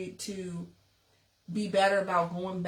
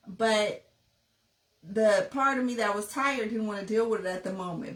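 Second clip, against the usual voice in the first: ordinary delivery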